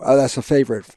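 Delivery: normal talking voice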